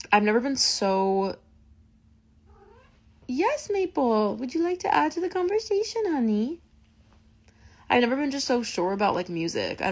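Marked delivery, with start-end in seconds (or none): 3.3-6.6 talking to the cat. Real, not acted. Interrupts herself to address cat.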